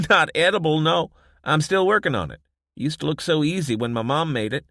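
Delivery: high, loud, amused self-mockery; laughing barkeep. Josie joking to Desmond about her bread, high and amused. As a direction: laugh off her failed baking.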